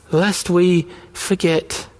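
slow, weighty repeat of lest we forget; slow solemn echo. Shown as a demonstration. Pastor echoing the refrain to the congregation, slow and solemn. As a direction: let each word land slowly. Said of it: "repeated line echoed next".

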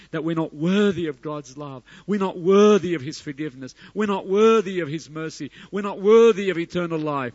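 loud, high, insistent repeated refrain; full-force preaching. Real, not acted. Preacher confessing unworthiness to congregation, full-force preaching. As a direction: hammer each line home like a preacher.

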